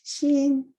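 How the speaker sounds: xin held high and level; high flat tone. Shown as a demonstration. Poet giving Chinese word for heart, xin in high flat tone. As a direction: say xin on a steady high Mandarin tone. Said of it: "in a high flat tone".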